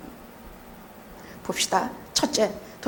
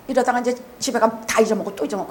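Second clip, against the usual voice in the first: usual speaking voice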